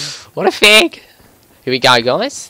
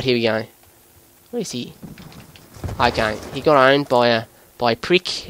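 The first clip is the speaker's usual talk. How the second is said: put-on Aussie gamer commentary; Australian accent. Gamer talking viewers through a match, Australian accent. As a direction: narrate the game in an Australian accent.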